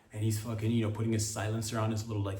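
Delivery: ordinary talk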